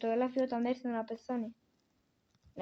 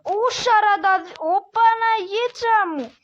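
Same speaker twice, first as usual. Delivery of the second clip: dismayed exclaim; 8 semitones higher, 9 dB louder than usual. Real, not acted. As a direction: moan it out like bad news just hit. Dismay at game result.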